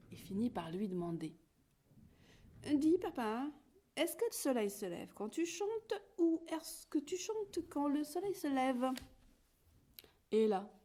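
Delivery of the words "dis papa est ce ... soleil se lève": high curious chick's question; little chick voice. A narrator's reading. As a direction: ask papa wide-eyed, pitched higher. Little chick asks rooster.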